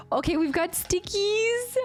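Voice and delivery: very high delighted squeals; excited squealing. Real, not acted. Unboxing stickers from her friend, ecstatic high squeals. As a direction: shriek with joy over the stickers.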